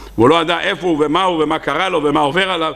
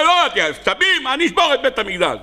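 higher, faster angry rant; mock furious outburst; 6 semitones higher than usual. God voiced raging against Israel, mock furious. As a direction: blow up like a furious king.

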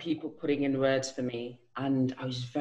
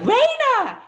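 high, loud shout of the name; surprised yell; surprised recognition; 8 dB louder than usual. Retold or imitated. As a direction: call out the name in shocked delight. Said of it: "she was like".

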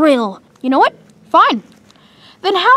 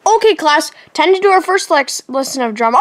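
usual speaking voice

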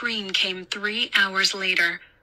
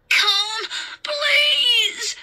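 very high, loud wail; drawn-out, crying beg; desperate child's plea; 4 dB louder than usual. A narrator's reading. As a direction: scream it like a terrified boy. Boy begs in the dark.

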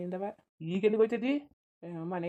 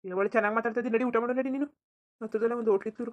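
insistent; 4 semitones higher, 6 dB louder than usual. Acted. Husband insists she eat.

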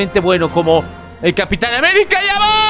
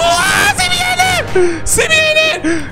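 panicked scream; much slower than usual. Real, not acted. Chased by a mob, screaming.

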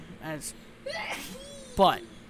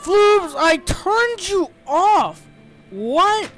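loud, high-pitched exasperated yelling; outraged shout; 9 semitones higher, 7 dB louder, much slower than usual. Real, not acted. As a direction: shout in disbelief at pesky game creatures. Outraged at game creatures returning.

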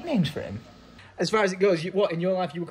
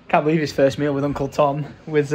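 normal talking voice

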